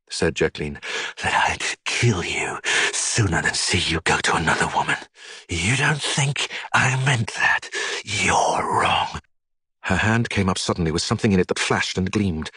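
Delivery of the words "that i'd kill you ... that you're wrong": hushed deadly threat; menacing whisper. A narrator's reading. Jacqueline threatens to kill.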